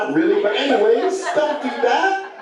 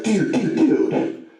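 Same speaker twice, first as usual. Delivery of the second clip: higher-pitched imitation of a dad hushing his kid; acted calming-down plea; 8 semitones higher than usual. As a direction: play a flustered dad reining in his son. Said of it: "trying to calm the kid down".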